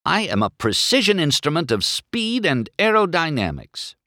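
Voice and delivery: high, indignant self-important complaint; angry grumble. A narrator's reading. McQueen indignant to Mater, grumbling angrily. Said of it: "McQueen grumbled angrily".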